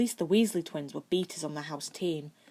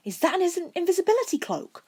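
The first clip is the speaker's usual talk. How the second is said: much higher and louder, eager; boy thrilled at seeing the cloak; excited; 13 semitones higher, 8 dB louder, faster than usual. A narrator's reading. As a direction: blurt it out thrilled. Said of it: "Fred asked with bright eyes".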